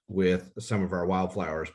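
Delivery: normal talking voice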